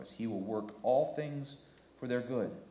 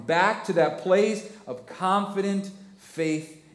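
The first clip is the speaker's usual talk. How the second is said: higher, louder, slow and weighty; preaching at full force; 9 semitones higher, 7 dB louder, slower than usual. Real, not acted. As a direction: drive it home to the church. Preaching climax.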